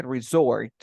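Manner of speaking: resort with the second syllable stressed, re-SORT; "resort" leaning on its final syllable; stress on the end of resort. Shown as a demonstration. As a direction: put the force of resort at the end. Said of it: "La fuerza de voz hasta el final".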